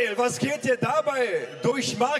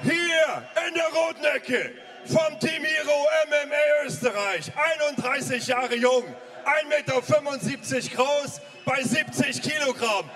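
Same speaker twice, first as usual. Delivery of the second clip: boxing ring announcer; 3 semitones higher than usual. Real, not acted. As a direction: announce the fighter to the crowd. Fighter introduction in the ring.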